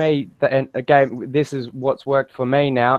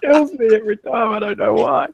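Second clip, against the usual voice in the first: much higher pitch, cracking up; laughing; 12 semitones higher, 4 dB louder than usual. Real, not acted. Guest cracking up at the accent bit, laughing. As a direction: squeal it through real laughter.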